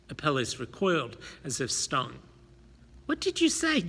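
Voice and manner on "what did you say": high shocked question. Acted. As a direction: recoil in disbelief. Apelles recoils as if stung.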